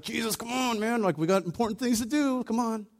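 exasperated father urging the teacher to hurry; impatient plea. Retold or imitated. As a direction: hurry him along, begging. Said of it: "I'm like".

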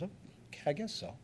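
high, very soft, hesitant giving-in; timid little frog voice; reluctant frog. Acted. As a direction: give in meekly in a small high voice. Frog gives in, in a fable.

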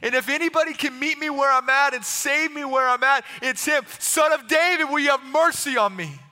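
loud, high desperate appeal; insistent yelling. Acted. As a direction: cry out over the crowd. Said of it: "the more he yelled".

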